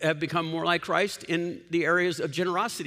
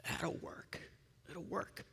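whisper; 15 dB quieter, slower than usual. Real, not acted. Aside after duty point.

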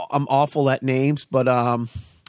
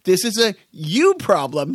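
ordinary talk, nothing marked

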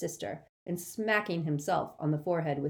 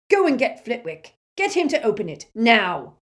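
barked order; 6 semitones higher, 11 dB louder than usual. A narrator's reading. Amycus barking orders.

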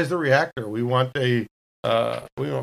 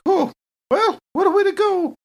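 high mock-breezy character voice; resigned quip; 4 dB louder than usual. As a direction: toss it off lightly, way up high. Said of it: "She's like".

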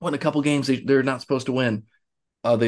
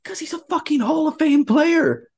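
exasperated high outburst. Real, not acted. Friend scoffing at people doubting the coach, high-pitched outburst. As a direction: pitch jumps way up in disbelief.

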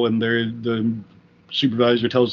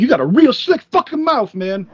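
much higher, louder threatening line; angry threat; 10 semitones higher, 4 dB louder than usual. Retold or imitated. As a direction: spit it out harder, furious. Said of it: "giving me a harder look".

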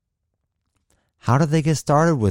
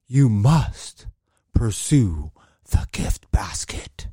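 awed passerby; slower than usual. Acted. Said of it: "a passerby is".

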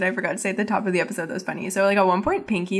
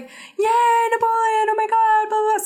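high-pitched pretend cheering; mock crowd cheer; 4 dB louder than usual. Host voices everyone greeting Napoleon, high mock cheering. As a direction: cheer like a thrilled crowd, half-seriously.

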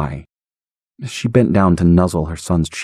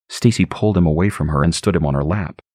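usual speaking voice

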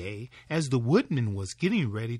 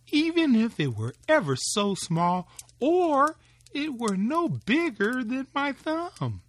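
higher and louder, yearning; longing wife; 8 semitones higher, 3 dB louder than usual. Acted. Wife wishing aloud for a child, higher and yearning. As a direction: plead for one small child.

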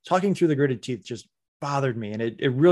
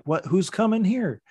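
puzzled onlooker; 7 semitones higher than usual. Retold or imitated. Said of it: "squinting, like".

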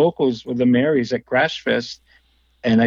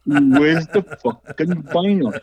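slow, higher mock-gruff demand, drawn out; 4 semitones higher, slower than usual. Retelling the friend nagging him for the vinyl, slow hammed-up demand. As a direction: lean on it like a friend nagging for his record. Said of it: "He goes".

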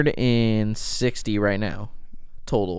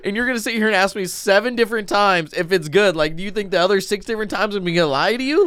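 exasperated; 6 semitones higher than usual. Retold or imitated. Acted exasperated reply to kid.

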